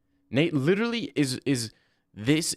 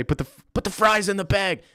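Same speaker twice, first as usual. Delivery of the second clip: mock heckle; 8 semitones higher, 4 dB louder than usual. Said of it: "go up to Nate Diaz with a camera and say".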